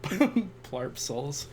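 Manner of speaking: normal talking voice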